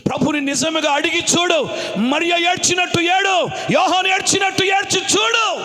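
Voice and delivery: high, mounting exhortation; full-force preaching. Real, not acted. Preacher urging believers to weep like Mary, impassioned. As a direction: climb phrase by phrase to a shout.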